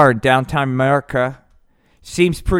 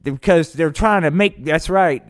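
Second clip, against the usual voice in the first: loud, high ranting patriot; high, loud blowhard rant; Hank; 6 semitones higher, 6 dB louder than usual. Acted. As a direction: yell it like a riled-up podcast host. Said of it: "that's Hank".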